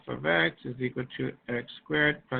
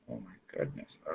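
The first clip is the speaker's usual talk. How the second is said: exasperated sigh; 7 semitones lower, 14 dB quieter than usual. Real, not acted. Class still silent.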